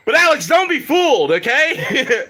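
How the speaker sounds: high, loud, through laughter; laughing. Real, not acted. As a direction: warn while laughing. Laughing warning.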